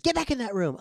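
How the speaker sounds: high, loud scolding yell; mom's yell. Retold or imitated. Mom catching the kid sneaking out, high loud scolding. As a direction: snap the kid back into the room.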